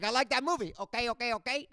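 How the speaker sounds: imitating dad's garbled McConaughey; dad's wrong quote. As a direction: mangle the quote eagerly. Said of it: "I was like".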